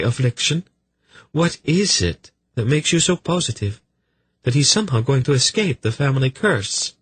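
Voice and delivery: high, brisk feminine questioning; sly young noblewoman, pressing. Acted. Velvet cornering Lady Tamizin about her son, sly and pressing. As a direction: press the point in a high, ladylike voice.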